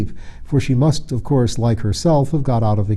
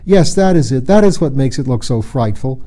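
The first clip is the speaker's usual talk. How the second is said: utter scorn; 2 semitones higher, 4 dB louder than usual. Acted. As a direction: spit out contempt for the moon. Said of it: "cried Photogen with utter scorn".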